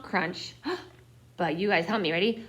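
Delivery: ordinary delivery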